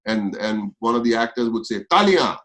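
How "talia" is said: raised, higher call to a film crew. Retold or imitated. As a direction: call for applause across the set. Actor calls crew to applaud.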